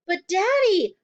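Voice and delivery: high, loud plea; pleading start. A narrator's reading. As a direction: beg her father. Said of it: "Matilda began".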